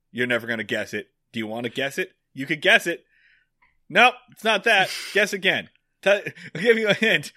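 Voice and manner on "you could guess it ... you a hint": teasing mock Barney. Barney teasing a guessing game.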